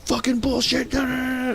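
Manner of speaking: high mock shout, spilling into filler syllables; imitated screaming. Brother rejecting the EU example, imitated shout. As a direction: yell over the other person's answer. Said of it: "screaming the top of his lungs".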